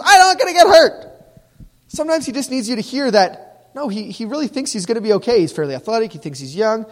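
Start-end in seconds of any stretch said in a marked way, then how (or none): 0.0-1.1 loud, high-pitched protest; defiant kid. Acted. Teenager answering a warning about getting hurt, defiant shout. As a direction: shout it back like a stubborn teenager. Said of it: "The kid says".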